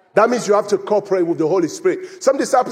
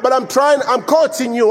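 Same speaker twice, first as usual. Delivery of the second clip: usual speaking voice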